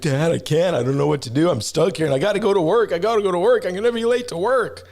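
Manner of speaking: high, rising panic as the son; panicking son. Retold or imitated. Dad mimics his stuck son, high and panicky. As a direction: mimic a frantic teen who will be late. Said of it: "And he's like".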